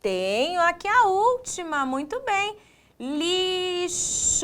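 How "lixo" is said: LIXO drawn out softly, higher; sounded-out syllables. Shown as a demonstration. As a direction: stretch both syllables of "Lixo". Teacher sounds out the word. ("Lixo" alongado, sílaba por sílaba)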